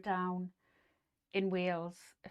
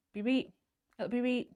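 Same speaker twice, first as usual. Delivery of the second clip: higher, louder Yorkshire "be right", with "right" said as "reet"; Yorkshire saying; 5 semitones higher, 6 dB louder than usual. Shown as a demonstration. Host showing viewers her picked-up saying, Yorkshire accent. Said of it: "obviously a Yorkshire saying".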